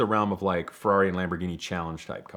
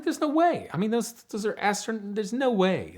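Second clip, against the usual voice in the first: incredulous denial; 11 semitones higher than usual. Retold or imitated. Speaker rejects a student's guess.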